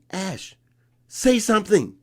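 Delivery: high, loud, outraged pleading; indignant young self. Retold or imitated. Boy begging Ashe to object to the tournament director, outraged. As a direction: push him to protest the unfair ruling. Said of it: "I'm going".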